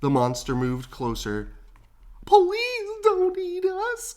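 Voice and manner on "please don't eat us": high, slow scared dog voices; frightened plea. A narrator's reading. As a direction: beg for your life, pitched way up. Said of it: "cried the dogs".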